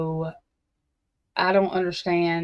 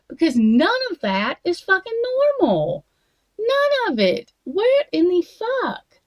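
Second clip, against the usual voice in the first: "None of it" cried out high and louder, furious disbelief; exasperated outrage; 10 semitones higher, 4 dB louder than usual. Real, not acted. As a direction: pitch climbing higher and louder in disgust. Disgusted rant about rich people.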